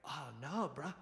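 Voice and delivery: a lightly voiced chill California bartender; a hint of a mellow bro; laid-back bartender. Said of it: "the bartender went".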